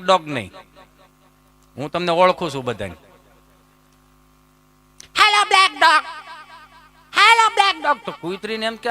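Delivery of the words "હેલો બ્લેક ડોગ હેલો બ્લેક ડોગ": high, bossy mock lady; higher mock lady calling dog; mimicking bossy lady. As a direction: shush her then call out high.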